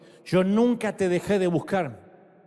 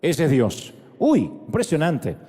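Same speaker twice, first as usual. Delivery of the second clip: ordinary talk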